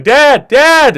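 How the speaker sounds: put-on high, loud children calling Dad; yelling kids. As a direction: shout for Dad like excited kids. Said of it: "They're yelling".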